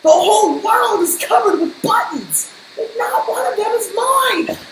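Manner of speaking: very high furious scream. Acted. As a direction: scream it in a tantrum.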